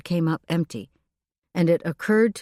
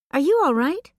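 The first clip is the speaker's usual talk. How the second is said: concerned coworker; 10 semitones higher, 4 dB louder than usual. A narrator's reading. Phyllis asks, concerned.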